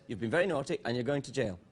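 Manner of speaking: low, soft, understated English detective; Foyle impression. Host plays a detective sending someone to jail, low quiet scolding. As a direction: quietly scold a naughty culprit, low and restrained. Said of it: "he talks like this".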